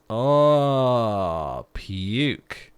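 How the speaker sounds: loud high groan of disgust. Real, not acted. Player reacting to corpse rot, loudly grossed out. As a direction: recoil from the smell.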